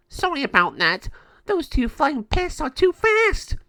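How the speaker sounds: high, loud cartoon henchman; whiny gargoyle. Gargoyle one excusing himself to El Jago, whining.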